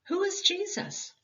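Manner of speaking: plain reading voice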